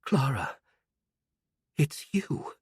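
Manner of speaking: breathless whisper; whispered in disbelief. Acted. An old woman seeing a girl she thought dead, whispering. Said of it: "she said in a trembling voice".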